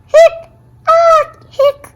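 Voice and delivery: high loud hiccups mixed with a squeal. Acted. Boy dodging a good luck kiss, squealing hiccups.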